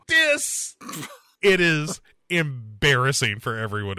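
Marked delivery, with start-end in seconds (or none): 0.1-0.8 high squealed taunt; squealed dis. Retold or imitated. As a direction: squeal it like a cheerleader. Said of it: "they both go".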